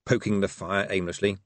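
usual speaking voice

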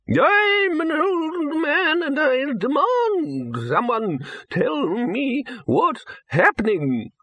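put-on high, slow panicky old wizard; a frail old man demanding answers; quavering old man. A narrator's reading.

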